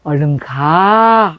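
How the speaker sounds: calm a worried daughter from behind; slow tender reassurance, voice dropped low; soft fatherly voice. Retold or imitated. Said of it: "그 부드러운 그 소리".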